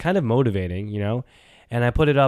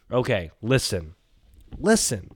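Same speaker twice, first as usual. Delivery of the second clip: usual speaking voice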